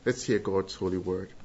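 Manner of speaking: ordinary talk, nothing marked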